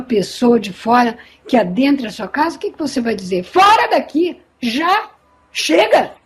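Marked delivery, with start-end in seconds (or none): 3.4-6.1 authoritative command. Shown as a demonstration. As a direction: bark it like the owner of the house. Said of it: "tem que falar com autoridade".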